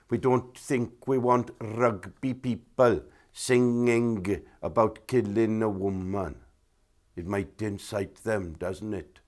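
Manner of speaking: slow, mock-Welsh official; Welsh accent. Welsh First Minister banning Delilah, in a Welsh accent. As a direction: put on a deliberate, drawn-out Welsh accent. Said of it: "Sorry about the Welsh accent there".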